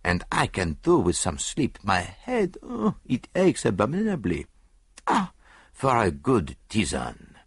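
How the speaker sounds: put-on accented detective lamenting his aching head; weary groans and longing; Poirot, weary and ailing. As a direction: complain theatrically in a foreign accent.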